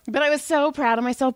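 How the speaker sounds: ordinary delivery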